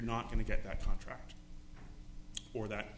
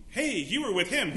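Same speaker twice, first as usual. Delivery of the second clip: accusing bystander; 5 semitones higher, 7 dB louder than usual. Retold or imitated. Accuser confronts Peter.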